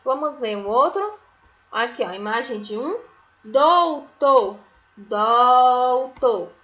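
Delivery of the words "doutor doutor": "doutor" as a loud, stretched "DOOU-TÔ"; two big, full beats on "doutor"; syllable by syllable. Shown as a demonstration. Teacher naming a picture for pupils, "doutor" in loud long syllables. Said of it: "inicia com a sílaba dó".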